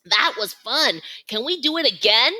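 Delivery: put-on excited child. Excited baby gorilla.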